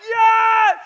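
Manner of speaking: triumphant high, loud shout; freaked out. Retold or imitated. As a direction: yell it in wild excitement. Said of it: "I freaked out".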